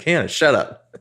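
a hint of an annoyed friend; lightly voiced irritated friend; mock snap. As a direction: cut her off, exasperated. Friend snapping at Hannah.

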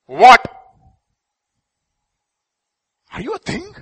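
loud, high preacher's cry; a pastor thundering scripture at the church; fiery preaching. Acted. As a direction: preach it at full force. Preaching at full force.

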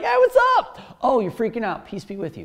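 high, loud, breezy voice of a casual Jesus. Retold or imitated. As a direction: toss it off like a casual hey-what's-up greeting. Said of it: "and he's like".

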